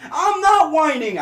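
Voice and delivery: put-on high, slow whiny kid voice. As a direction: whine it like a sulky child. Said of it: "I'd say in a whiny voice".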